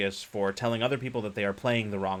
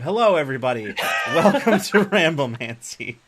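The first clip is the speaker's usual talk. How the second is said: show-opening greeting; 4 semitones higher, 8 dB louder than usual. Real, not acted.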